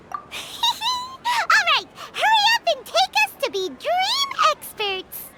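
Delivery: excited. Acted. Paimon eagerly accepts title.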